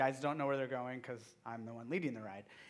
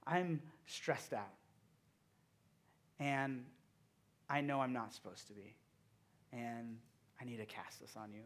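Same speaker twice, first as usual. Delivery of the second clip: quiet prayer; 4 semitones lower, 6 dB quieter than usual. Retold or imitated. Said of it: "I'm sitting there going".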